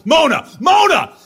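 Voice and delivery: loud high call down the hall. Acted. Father calling his wife down the hall, loud. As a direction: yell for her across the house.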